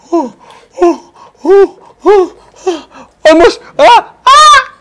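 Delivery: loud, high laughing yells; excited yelling. Real, not acted. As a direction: cheer and laugh as it almost lands. Game excitement, laughing shouts.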